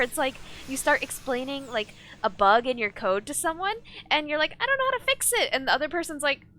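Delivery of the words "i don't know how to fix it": high-pitched put-on complaint; mock helpless coder. Coder explaining a bug to someone, mock helpless. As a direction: play a stuck coder, pitch way up.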